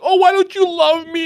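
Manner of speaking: high, loud whiny imitation; mock whining. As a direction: moan it like a lovesick crybaby, way up high. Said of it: "he bitches and moans".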